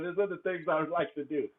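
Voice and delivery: ordinary delivery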